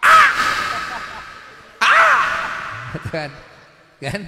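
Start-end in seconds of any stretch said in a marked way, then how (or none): 0.0-3.0 high, sudden yelled "ah!"; mock wife's shouted "ah"; too-loud "ah". Shown as a demonstration. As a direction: demonstrate an overly loud voice with a piercingly high cry. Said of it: "tapi suaranya terlalu keras".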